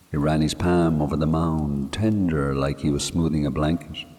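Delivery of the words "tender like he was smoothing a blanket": usual speaking voice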